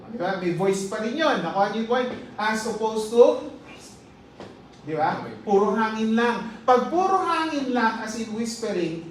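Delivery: ordinary delivery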